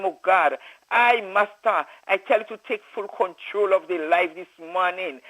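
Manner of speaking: normal talking voice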